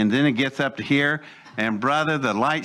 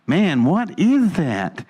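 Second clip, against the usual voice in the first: slow, higher-pitched wondering question; amazed; 5 semitones higher, 3 dB louder, slower than usual. Retold or imitated. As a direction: re-enact baffled amazement, drawing it out. Retold amazement at changing hull.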